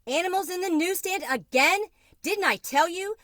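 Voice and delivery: high, loud accusing shout, rising on again; Mama scolds Mario for letting animals in; angry scolding. A narrator's reading. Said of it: "all her despair changing into anger".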